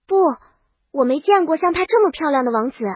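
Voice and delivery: ordinary delivery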